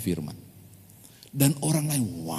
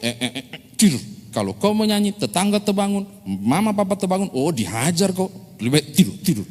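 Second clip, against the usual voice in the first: higher granny voice, firm; grandma warning grandson about the neighbours, raised; 7 semitones higher, faster than usual. Acted. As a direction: shoo the child back to bed.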